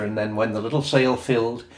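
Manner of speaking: ordinary delivery, nothing marked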